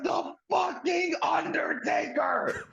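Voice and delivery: high, drawn-out fan scream; ecstatic disbelief. Retold or imitated. Fan reliving the Undertaker's gong hitting, high slow screaming disbelief. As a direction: shriek it slowly, stretching every word. Relives his shock at the entrance.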